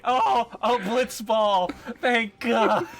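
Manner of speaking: high, slow, exaggerated relieved voice; hammed-up relief. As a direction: gush with over-the-top relief. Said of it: "I love that he's like".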